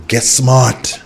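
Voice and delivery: ordinary talk, nothing marked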